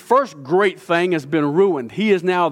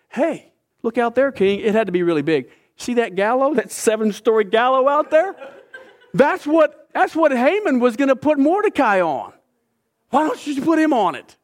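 high, eager voice with a laugh in it; gleeful tip-off; 7 semitones higher than usual. Retold or imitated. A eunuch urges the king to use Haman's gallows, high and gleeful. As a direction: relish pointing out the gallows.

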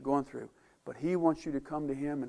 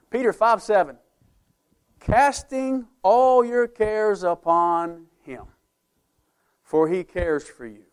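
solemn scripture reading; 3 semitones higher, 6 dB louder, slower than usual. Acted.

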